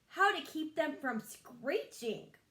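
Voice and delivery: drawn-out, fed-up complaint; slow and pained, ears covered; put-upon groan of a line; annoyed, slow. Acted. Barnaby B holding his ears.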